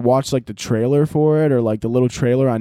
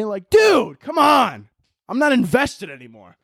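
exasperated; 6 semitones higher, slower than usual. Real, not acted. Exasperated complaint about dubbing.

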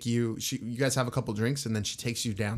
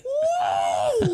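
put-on very high drunk granny whoop; wild whoop; 4 dB louder than usual. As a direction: let out a crazed old lady yell. Grandma going crazy, shout.

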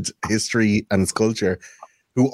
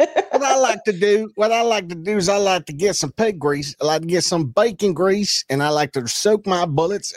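put-on southern soldier accent; 9 semitones higher than usual. Mocking a bragging southern soldier in a southern accent. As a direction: play a smug southern gun lad in a southern accent. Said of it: "the lads down there in the south".

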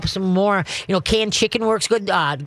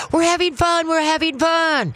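high, bubbly and upbeat; cheerleader voice; 9 semitones higher than usual. Shown as a demonstration. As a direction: sell the fun like a cheerleader. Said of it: "cheerleader voice on".

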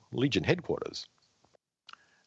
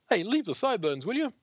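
indignant; faster than usual. Acted. Denzel complaining after attack.

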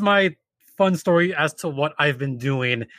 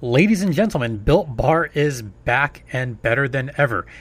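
louder announcer sponsor pitch; ad read; 3 dB louder than usual. Acted. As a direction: sell it like a host ad.

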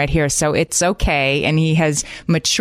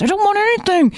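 put-on high, whining teenage refusal; cranky teenager whining about breakfast; whiny teen. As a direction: pitch it up into a sulky teen whine.

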